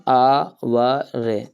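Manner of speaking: आवर stretched very long and slow, the h silent; drawn-out "hour". Shown as a demonstration. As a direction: draw out आवर slowly, like a teacher's model. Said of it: "इसको पढ़ते क्या अपन". (आवर धीरे-धीरे खींचकर बोलना)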